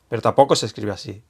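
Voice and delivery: ordinary talk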